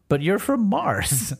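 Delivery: high, slow protest in mock disbelief; mock incredulous. Mover questions a Martian who likes Oreos, mock incredulous. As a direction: act baffled, pitch jumping up, drawn out.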